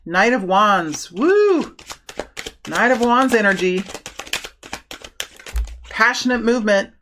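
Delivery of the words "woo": high, drawn-out "woo"; excited whoop. Real, not acted. Tarot reader reacts to the Knight of Wands, excited whoop.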